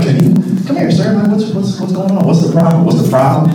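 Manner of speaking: lightly voiced store clerk. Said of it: "They're like".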